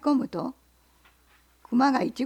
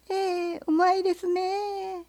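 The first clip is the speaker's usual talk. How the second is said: fox; 8 semitones higher, slower than usual. Acted. Fox forcing politeness.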